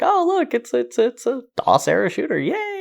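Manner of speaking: high-pitched gushing nostalgia, played up; mock excitement. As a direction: squeal a fake little "yay" of delight. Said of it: "you sounded excited at the beginning".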